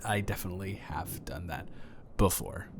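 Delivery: confiding whisper; whispered aside. Acted. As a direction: drop into a secretive whisper. Confessing an aside to the neighbor.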